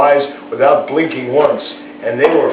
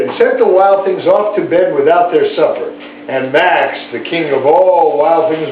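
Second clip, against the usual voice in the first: plain reading voice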